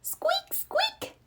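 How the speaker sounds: tiny high whispered squeak, rising; breathy little creak sound; squeaky swing noise. Acted.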